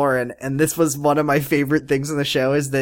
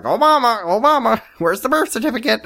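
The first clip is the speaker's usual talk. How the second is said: high-pitched cockatoo repeating phrases; silly high bird chatter; talking bird; 7 semitones higher than usual. Acted. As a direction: squawk it like a trained parrot. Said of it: "he's just like".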